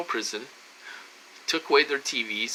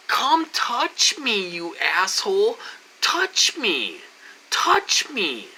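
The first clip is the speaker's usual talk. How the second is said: high, drawn-out goading challenge; cocky taunt, pitch swooping down; acted defiant dare; 6 semitones higher, much slower than usual. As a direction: dare him to try it, hammed-up. Retold challenge to the punk.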